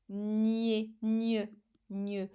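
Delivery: "nié" then "gne" twice, each isolated and clearly articulated; sound demonstration. Shown as a demonstration. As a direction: model the sound "gne" slowly for young learners. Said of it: "qui contient le son".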